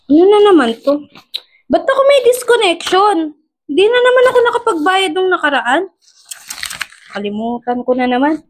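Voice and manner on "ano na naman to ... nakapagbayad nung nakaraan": exasperated, higher-pitched questioning; dismayed. Acted. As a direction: pitch jumping up in disbelief. Dismayed at disconnection notice.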